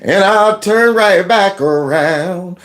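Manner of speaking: lightly voiced guard, slow daring delivery; imagined security guard. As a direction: draw out a mock threat, very slowly. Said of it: "I'd be in my head like".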